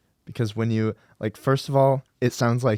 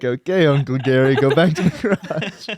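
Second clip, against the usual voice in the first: humoring the uncle; 4 semitones higher, 7 dB louder than usual. Retold or imitated. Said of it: "And then you're like".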